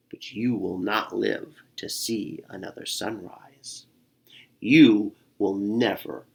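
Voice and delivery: growling bear. Acted. Bear pinning the squirrel, slow low menace. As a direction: threaten him slowly under your breath. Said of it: "Bear growled".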